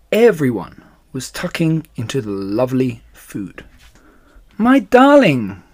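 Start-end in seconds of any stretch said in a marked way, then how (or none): 4.6-5.6 high, loud and drawn-out cry of relief; crying out to her husband in joy; wife greeting her returning husband with a joyful cry. Acted. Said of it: "cried Missus Fox".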